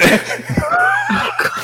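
high-pitched burst of laughter; helpless squealing laugh. Real, not acted. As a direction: crack up at your own impression. Cracks up after own impression.

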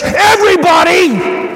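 high, loud triumphant answer; exultant shout. Real, not acted. Rousing call-and-answer boast about God.

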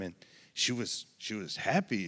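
ordinary talk, nothing marked

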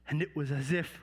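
ordinary delivery

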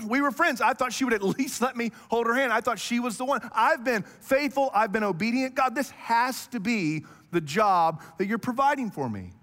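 petulant mock lament; whiny complaint. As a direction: whine like a let-down believer. Voicing complaints to God.

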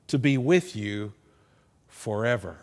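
"forever" set apart by a pause, falling low; pause, then let "forever" drop with weight; stress on forever. Retold or imitated. Jesus promising the disciples another helper, stress on "forever". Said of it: "Jesus probably emphasized that word".

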